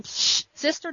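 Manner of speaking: high, dismissive shush, acted; imitated sisterly scolding; mock shushing.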